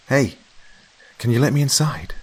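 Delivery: a friend calling through the door; Mary's voice. A narrator's reading. Mary knocking, voiced in story.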